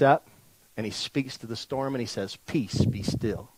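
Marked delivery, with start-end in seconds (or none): 2.5-3.5 very soft, low and slow; calm command. Acted. Jesus speaking to the storm, soft calm command. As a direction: hush it gently, unhurried.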